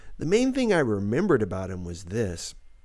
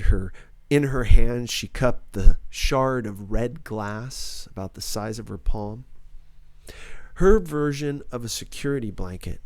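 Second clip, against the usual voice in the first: ordinary delivery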